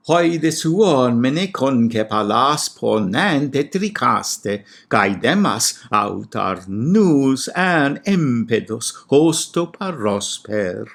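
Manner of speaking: slow, loud ancient Greek with pitch accents; overemphasized Greek recitation. Shown as a demonstration. Scholar reading Homer's Greek aloud, overstressing the accents. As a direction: chant the Greek hexameter, leaning hard on accents. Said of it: "which I rather overemphasized".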